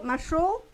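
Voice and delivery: Masho with the force on the final "sho"; stress on second syllable. Shown as a demonstration. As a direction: say Masho, leaning on the last syllable. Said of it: "la segunda sílaba lleva la, la fuerza de pronunciación".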